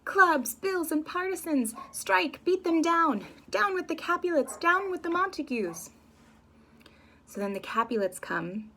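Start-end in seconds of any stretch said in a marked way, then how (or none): 0.0-5.9 high, loud battle cry; rally the crowd at full yell; shouted high call to arms; screaming officer. Acted. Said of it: "screaming at this point".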